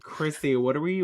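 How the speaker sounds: ordinary talk, nothing marked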